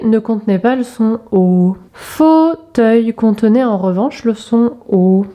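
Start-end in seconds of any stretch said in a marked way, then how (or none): 1.9-3.1 drawn-out, separated syllables. Shown as a demonstration. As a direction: stretch "Fauteuil" over two clear syllables. Syllable exercise, picture word.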